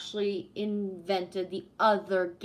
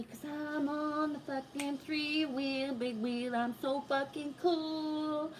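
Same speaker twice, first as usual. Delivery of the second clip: put-on higher and slow, a gleeful six-year-old; mock-thrilled kid; 6 semitones higher, 4 dB quieter, slower than usual. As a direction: savour the joyride like a proud little kid. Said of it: "so excited and so happy".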